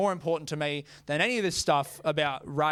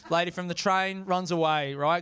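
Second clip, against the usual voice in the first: ordinary talk, nothing marked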